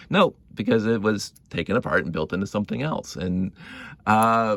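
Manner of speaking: usual speaking voice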